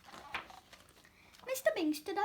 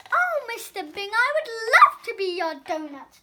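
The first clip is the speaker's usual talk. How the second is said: overjoyed; 3 semitones higher, 11 dB louder than usual. A narrator's reading. Donut joyfully accepts.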